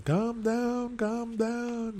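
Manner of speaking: high, stretched-out pleading; imitated peacemaker. Bystander to drunk people in street, imitated peacemaker. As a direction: mimic someone trying to settle a crowd. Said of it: "somebody's saying".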